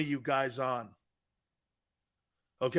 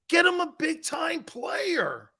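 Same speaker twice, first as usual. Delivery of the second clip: higher, slow, drawn-out shouted demand; emphatic plea; 9 semitones higher, slower than usual. Real, not acted. To the chat about the team, a slow shouted plea. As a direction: beg the front office at full voice. Pleading for team to add talent.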